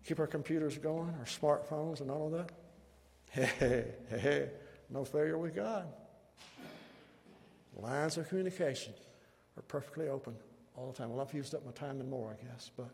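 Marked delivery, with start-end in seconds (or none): none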